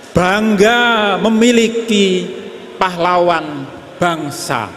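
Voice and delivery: solemn patriotic poem recital; poem recitation. Acted. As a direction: declaim it like a tribute. Recites slide text as poem.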